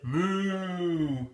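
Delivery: mooing cow sound. Acted. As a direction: moo like a cow.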